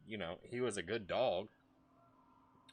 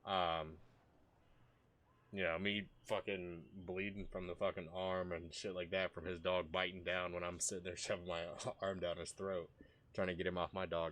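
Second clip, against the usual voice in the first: ordinary delivery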